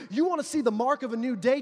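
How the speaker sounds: normal talking voice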